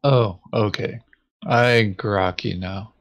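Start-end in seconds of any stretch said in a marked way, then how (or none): none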